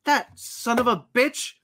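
imitating a wronged man, high and slow; drawn-out, high-pitched mock outrage; outraged realization. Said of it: "he figures it out. He's like".

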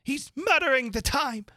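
high, loud royal bellow; bawling. A narrator's reading. The Queen bawling at the Hatter, loud and high. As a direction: bawl it out like a furious queen. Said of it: "bawled out".

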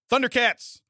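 sudden high, loud blurt; imitated vocal tic. Imitating a TV contestant's tic, sudden shout. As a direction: blurt it out as an involuntary cry. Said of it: "her, her tic is".

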